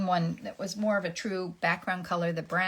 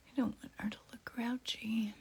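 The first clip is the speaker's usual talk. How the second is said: whispering; 5 semitones higher, 8 dB quieter than usual. Real, not acted. Whispered aside.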